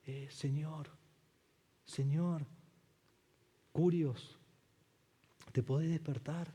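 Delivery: soft, lazy mock-polite request; sarcastically gentle whisper-like; mock calm quiet. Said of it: "tranquilitos, en voz baja". (pedido tranquilo y burlón en voz baja)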